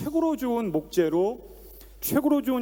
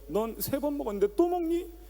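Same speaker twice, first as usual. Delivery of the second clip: a higher mock-exasperated mom voice; scolding mother; 9 semitones higher than usual. Mother scolding a child who eats too much, in mock exasperation. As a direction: scold the kid like a tired mother.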